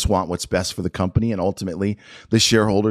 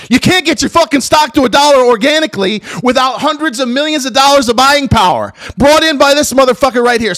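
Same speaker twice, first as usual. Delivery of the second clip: loud, high, furious outburst; enraged rant; 7 dB louder than usual. Real, not acted. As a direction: blast it out in anger. Enraged rant about the CEO.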